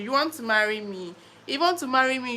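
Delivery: normal talking voice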